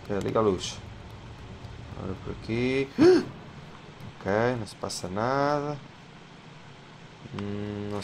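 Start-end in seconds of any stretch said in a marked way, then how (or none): 2.9-4.1 short high gasp of shock; frightened gasp. Real, not acted. Gamer startled by something in the dark, sharp gasp. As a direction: catch your breath in fright. Sudden scare in game.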